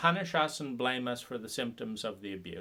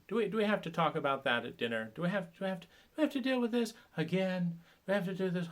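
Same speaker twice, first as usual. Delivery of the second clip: higher, whiny do we have to; mock exasperation; 6 semitones higher, faster than usual. Family member at dinner, whining in mock exasperation. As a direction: sigh it out as the put-upon family.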